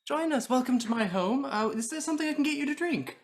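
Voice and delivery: high, gushing hospitality; effusive host, pitched well up; dazzling host. Acted. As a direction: usher the guest in with showy delight. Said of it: "being the dazzling host".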